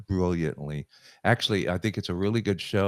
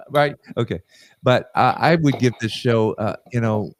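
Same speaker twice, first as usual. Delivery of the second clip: ordinary talk, nothing marked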